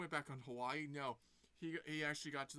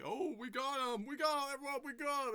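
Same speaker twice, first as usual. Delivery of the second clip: mock triumphant cheer; 10 semitones higher, 6 dB louder than usual. Agents gloating after catching Sonic, loud cheering. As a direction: cheer like you just won.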